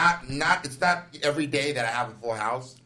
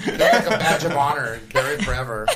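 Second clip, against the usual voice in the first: normal talking voice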